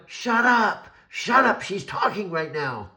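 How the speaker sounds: high, loud retold yell, easing at the end; reenacted yelling at Alexa to hush for a friend; yelled shut up. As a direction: shout them down, then explain. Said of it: "And I was going".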